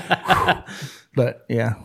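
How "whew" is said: breathy blown-out "whew"; voiceless sigh of relief; relieved exhale. Retold or imitated. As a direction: act out relief with a puff of air. Acts out relief at releasing.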